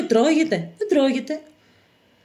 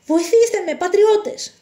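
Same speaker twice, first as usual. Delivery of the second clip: high, desperate call for help; hunted man's urgent appeal; urgent plea for help; 8 semitones higher, slower than usual. Acted. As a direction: cry out to strangers for rescue. Fugitive calls for help.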